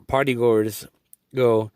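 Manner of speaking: normal talking voice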